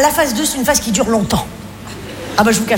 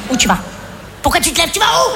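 surprised wife; 6 semitones higher than usual. Acted. Wife suddenly alarmed.

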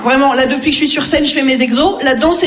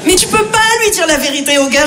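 ordinary talk, nothing marked

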